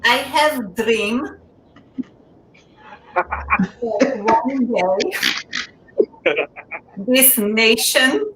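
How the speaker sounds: slow, halting mock Slovenian accent; Melania impression. Comic mocking the first lady's speech in a slow Slovenian accent. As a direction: drag out each word in a mock Slovenian accent. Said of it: "I'm gonna quote Melania's speech".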